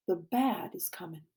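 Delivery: low, hushed foreboding; ominous whisper. A narrator's reading. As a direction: whisper a dreadful premonition. Dreadful thought of danger coming.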